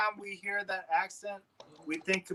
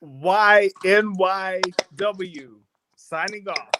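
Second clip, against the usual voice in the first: slow, louder radio-style sign-off; drawn-out sign-off; 8 dB louder, much slower than usual. Real, not acted. Caller leaving the show with his station tag, slow and loud. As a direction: stretch out the call letters like a DJ.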